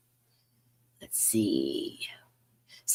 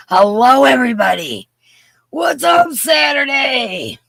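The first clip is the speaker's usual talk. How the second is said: calling out a greeting; 9 semitones higher, 6 dB louder than usual. Real, not acted. Host greets her stream viewers, calling out loud and high. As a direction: holler it out, stretching the last word.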